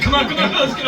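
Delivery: lightly voiced panicked rookie; mock hurry. Co-host voicing nervous rookies.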